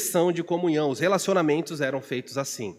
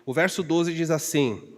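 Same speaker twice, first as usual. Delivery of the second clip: ordinary talk, nothing marked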